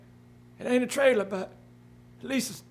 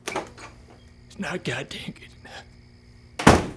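low slow whispered scorn; bitter whisper; 9 semitones lower, much slower than usual. Acted. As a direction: breathe it out slowly, cutting. Randy's bitter dismissal.

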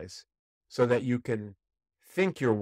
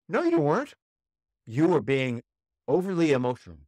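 scolding attorney; 4 semitones higher, 4 dB louder than usual. Retold or imitated. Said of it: "They say".

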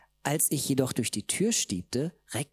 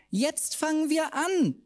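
high, slow gleeful cry; high drawn-out exulting. Acted. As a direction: rejoice at the start. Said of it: "frohlockte meine Schwester".

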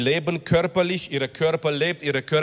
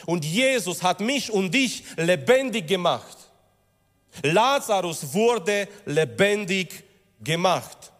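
higher, driving sermon voice; impassioned preaching; 4 semitones higher than usual. Acted. Preacher urging the congregation about their rebirth, impassioned. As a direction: preach it with rising fervour. Preacher rises to full force.

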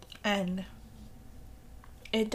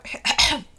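sudden coughing fit; choking cough; 3 semitones higher, 11 dB louder than usual. Real, not acted. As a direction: cough hard, caught off guard. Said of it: "Who starts off a podcast choking?".

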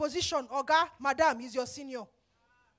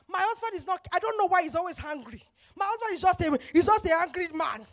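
louder, exasperated mock complaint; complaining wife; 2 semitones higher, 6 dB louder than usual. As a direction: act out a wife griping about her husband. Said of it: "And then you say that".

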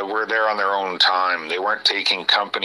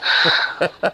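short unvoiced giggle; can't hold a straight face, laughing; breathy laugh; 7 semitones higher than usual. Real, not acted. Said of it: "I can't even say that with a straight face".

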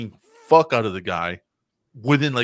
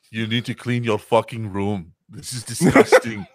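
mock scolding robot; 2 semitones higher, 6 dB louder than usual. Terminator scolding about the room.

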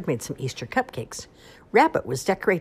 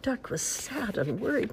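ordinary delivery, nothing marked

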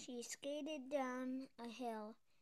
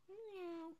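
kitten meow; 6 semitones higher than usual. Acted. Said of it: "the kitten replied".

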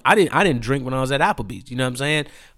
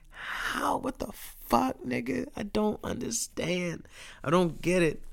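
softer, high, breathy disbelief; stunned disbelief; 8 semitones higher, 10 dB quieter than usual. Real, not acted. Telling viewers the friend felt fine, hushed baffled disbelief. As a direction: barely get the words out, baffled.

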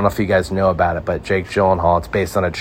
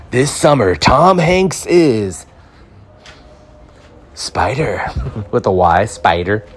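higher, very slow dramatic trailer announcer; movie trailer voice; 3 semitones higher, slower than usual. Pretend. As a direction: build suspense like a cinema trailer. Mock trailer for the film.

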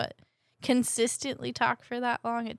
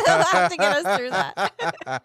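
laughing; 3 semitones lower, 11 dB louder, slower than usual. Real, not acted. Laughing while talking.

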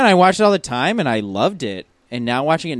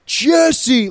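high, loud cry of recognition; surprised shout; 9 semitones higher, 6 dB louder than usual. Retold or imitated. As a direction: shout the name in sudden discovery. Imagined big reveal shout.

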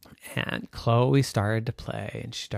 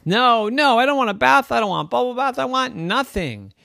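put-on high whiny protest of a little girl; Chloe, protesting child; 10 semitones higher than usual. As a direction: refuse the bath in a high child voice. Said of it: "said Chloe".

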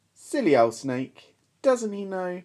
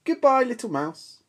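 high, quick goodbye; fox hurrying away; 10 semitones higher, 3 dB louder than usual. Acted. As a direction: back off fast and high. Fox takes leave.

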